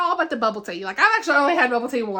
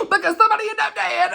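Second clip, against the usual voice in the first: fast, laughing through the words; 8 semitones higher, 4 dB louder, faster than usual. Real, not acted. Reviewer laughs while revealing the murder, fast and laughing. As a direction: giggle out the twist. Laughing at dark plot twist.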